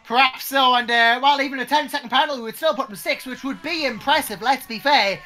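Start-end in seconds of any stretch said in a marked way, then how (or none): none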